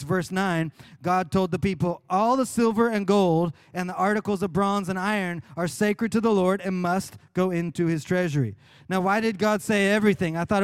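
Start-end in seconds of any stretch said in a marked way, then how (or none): none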